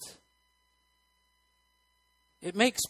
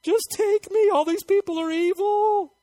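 much higher, whiny pleading voice; hammed-up weary believer begging Jesus; mock despairing prayer; 13 semitones higher, 4 dB louder than usual. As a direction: beg to be taken away, overdone. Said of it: "it's like".

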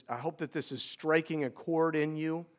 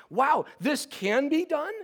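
amazed wonder; 7 semitones higher, 3 dB louder than usual. Retold or imitated. Son marvelling at his dying father's faith, higher and amazed. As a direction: marvel that it can be done. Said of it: "battle raging inside of me because I'm like".

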